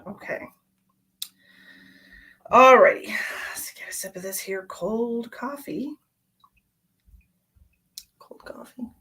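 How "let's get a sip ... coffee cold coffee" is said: soft whisper to herself; whispered aside. Real, not acted. Streamer sipping cold coffee, whispered aside. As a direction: whisper it off to the side.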